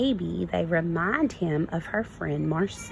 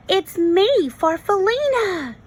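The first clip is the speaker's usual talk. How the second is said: higher, louder joyful shout; 11 semitones higher, 6 dB louder than usual. Acted. Goose shouts to the butterfly, overjoyed. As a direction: call out in joyful recognition. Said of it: "he shouted".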